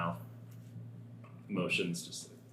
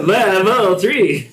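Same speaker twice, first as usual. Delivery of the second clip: shouted through laughter, drawn out; laughing shout; 8 semitones higher, 7 dB louder, much slower than usual. Real, not acted. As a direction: yell it again, cracking up. Repeating the shout while laughing.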